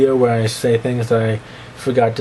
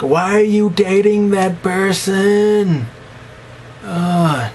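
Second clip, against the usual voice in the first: high, drawn-out questioning whine; whiny slow put-on voice; 8 semitones higher, much slower than usual. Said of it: "I'm like".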